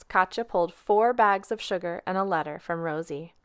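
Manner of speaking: plain reading voice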